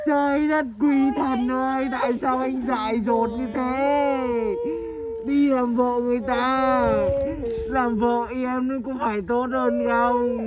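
long-drawn theatrical lament; keen over him, stretching each line's end; hammed-up crying. Scolding Bốn for marrying someone else, melodramatic wailing. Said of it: "khóc gì mà khóc dữ thế".

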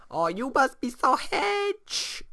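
high, slow put-on voice; mock sympathy. Gamer teasing a tired sim in high mock voice. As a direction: coo it like a silly sim.

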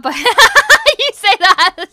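slow words broken by laughter; laughing. Real, not acted. Streamer laughing as she answers a viewer's joke. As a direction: laugh your way through it, high and loud.

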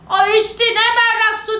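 high, loud indignant retort; defiant river. Acted. River defends the boy to the giant, loudly defiant. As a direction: answer the giant back at full voice.

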